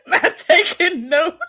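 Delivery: can barely get it out for laughing; real laughter. Real, not acted. Cohost cracking up at the Mister Clean joke, laughing hard.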